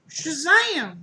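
high, loud comic burst; big playful high-pitched reveal; hammed-up exclamation. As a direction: shout it like a mock magic word.

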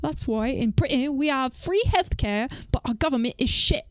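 put-on British accent. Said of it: "I have to say this in a British voice".

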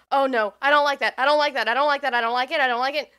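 loud, frightened repeated protest; panicking as something closes in; real fear, voice rising loud; scared. Real, not acted. Jump scare building in horror game.

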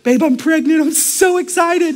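high, acted excited wife; excited announcement. Mary announcing pregnancy to Joseph.

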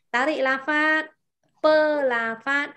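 slow pơ la phát for repeating; teacher's slow model reading; slow demo. Shown as a demonstration. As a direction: spell out the breathing words slowly. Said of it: "Hít vào và thở ra một cách chậm chậm".

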